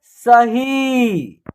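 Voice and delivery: सही as one word with a long held ही; blended syllables. Shown as a demonstration. Teacher showing how it reads together, सही slowly blended. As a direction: say सही very slowly, stretching the final ई. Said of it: "तो एक साथ उच्चारण करेंगे".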